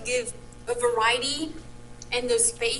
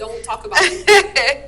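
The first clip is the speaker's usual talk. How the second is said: laughing; 9 dB louder, slower than usual. Real, not acted. Caught already tasting, laughing.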